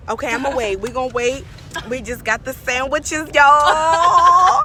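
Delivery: loud hyped call to viewers; loud announcing. Real, not acted. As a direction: shout it to the audience.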